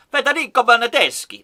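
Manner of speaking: confident Italian accent on the retry; proper Italian go. Shown as a demonstration. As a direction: nail the Italian accent this time. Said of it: "There you go".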